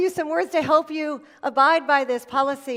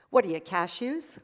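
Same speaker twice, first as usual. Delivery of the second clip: cheeky joke; 4 semitones lower than usual. Retold or imitated. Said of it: "He said".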